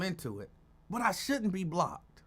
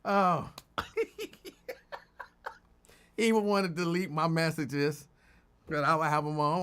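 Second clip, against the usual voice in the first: ordinary delivery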